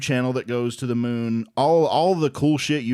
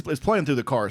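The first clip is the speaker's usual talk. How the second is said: ordinary talk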